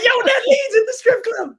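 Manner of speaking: very high, loud retold holler; excited call-out. Man retelling spotting someone at the club, excited call-out. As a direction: call out the sighting, voice shooting way up.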